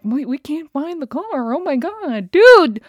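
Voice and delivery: high, wavering mock dismay, bursting loud at the end; mock panicked staff. Staff admitting they can't find the car, mock panic.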